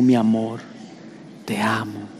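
soft, low "mi amor"; mildly tender whisper to a wife. Retold or imitated. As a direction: murmur it to your wife. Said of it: "le decimos".

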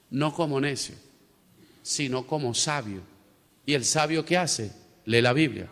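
quiet pastoral preaching; hushed preaching. Acted. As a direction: preach softly, drawing them in. Hushed stretch of the sermon.

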